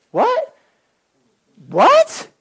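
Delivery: high and loud, startled; shocked. Retold or imitated. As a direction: jolt up in disbelief. Shocked reaction to the temptation.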